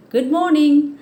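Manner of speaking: high, loud happy Good morning; cheerful greeting. Shown as a demonstration. Teacher demonstrating the hedgehog's happy greeting to children. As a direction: beam it out happily. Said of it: "in a very happy mood".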